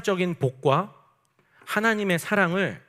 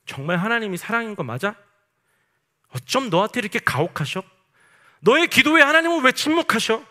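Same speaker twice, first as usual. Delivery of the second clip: higher and louder, a taunting accuser pushing harder and harder; Satan's taunting voice; 7 semitones higher, 6 dB louder than usual. Acted. Preacher voicing Satan mocking a suffering believer, high loud taunt. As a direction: sneer like the tempter, building to a loud, high jab. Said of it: "사탄이 말하는 거예요".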